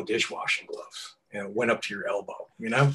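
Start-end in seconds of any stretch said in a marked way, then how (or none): none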